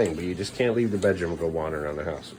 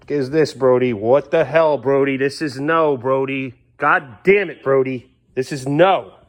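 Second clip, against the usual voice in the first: real angry scolding, raised and loud; exasperated scolding; 5 semitones higher, 6 dB louder, slower than usual. Real, not acted. Finds food smushed on couch.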